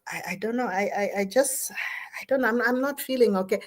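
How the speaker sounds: soft, halting, unsure self-talk; hesitant inner voice. Acted. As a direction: stammer it quietly, as if admitting it to yourself. Acting out troubled inner voice.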